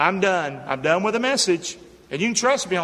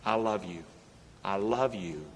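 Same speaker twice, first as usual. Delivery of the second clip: tender; 9 semitones lower, 9 dB quieter than usual. Real, not acted. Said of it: "I wanna tell you today three words".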